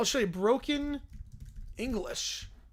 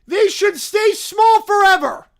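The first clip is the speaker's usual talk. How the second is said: loud, high, slow caveman shout; 9 semitones higher, 13 dB louder, slower than usual. Acted. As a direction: bellow like a dumb caveman. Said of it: "why is it talking like a caveman".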